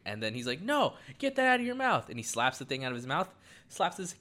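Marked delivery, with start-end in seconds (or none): 0.6-2.1 high, loud frantic shout at his son; retold dad shrieking at his melting kid; panicked yell. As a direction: yell it in horror, pitch flying up.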